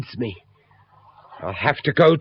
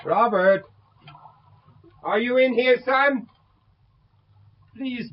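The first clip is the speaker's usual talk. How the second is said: high, urgent calling for his son; pleading father; 8 semitones higher than usual. Acted. Father searching the dark lodge, calling high and pleading for his son. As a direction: beg the hidden boy to answer.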